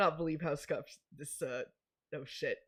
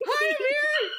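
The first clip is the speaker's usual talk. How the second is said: high, loud, excited hello; excited greeting; 7 dB louder than usual. Real, not acted. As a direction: greet him with delight. Greeting a guest.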